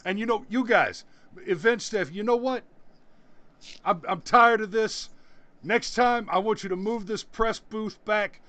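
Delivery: a light send-up of a politician on stage; Donald Trump. Retold or imitated. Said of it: "He said".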